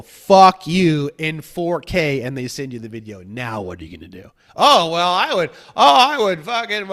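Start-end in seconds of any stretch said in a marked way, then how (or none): 0.0-1.1 much louder, a spat-out insult; mock taunt. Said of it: "they go". 4.6-6.9 higher and louder, spluttering; a know-it-all caught off guard; flustered purist. Retold or imitated. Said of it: "I literally talk like that".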